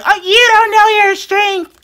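high, slow mock cousin voice; a squeaky teasing impression of her cousin; imitating cousin. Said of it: "what my cousin says all the time".

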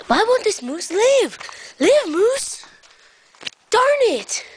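high, whiny frustrated yell; hammed-up frustration; mock exasperation. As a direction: plead and scold with swooping high pitch. Frustrated with lifeless moose.

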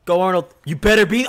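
ordinary talk

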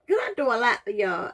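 ordinary talk

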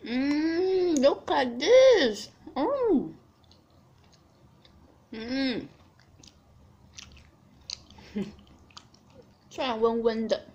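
food in mouth; muffled between bites; talking while chewing. Real, not acted. Seen 2: Bit chicken just before; mouth closed, chewing between words, holding piece.

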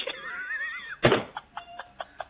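breathy falsetto giggle; very high squeaky laugh. Real, not acted. Ben laughs as he reveals his own prank.

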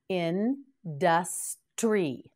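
Industry said very slowly, each syllable drawn out; very slow model word. Shown as a demonstration. Teacher says the word very slowly for the class to repeat. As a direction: stretch it out for the learners. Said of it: "Now you say it".

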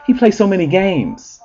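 high, put-on whiny voice; lightly hammed-up teen boy; mock dude voice. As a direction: whine it like a clueless teen boy. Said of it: "He's like".

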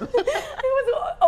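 drawn out, slow words broken by laughter; laughing. Real, not acted. Host struggles to name the accent, laughing and slow. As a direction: laugh through the words slowly.

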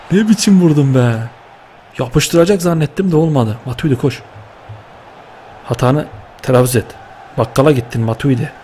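ordinary delivery

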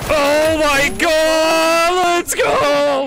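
loud high celebration yelling; hype scream. Real, not acted. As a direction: scream in triumph. Big kill celebration, screaming.

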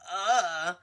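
a short, dazed "Uh" as if lost for words; stunned groan. Retold or imitated. Vanessa, stared at by everyone, gives a stunned groan. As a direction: let out a weak, shaken grunt. Vanessa reacts after the attack.

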